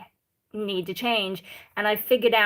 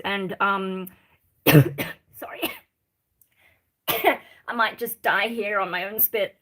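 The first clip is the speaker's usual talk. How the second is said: usual speaking voice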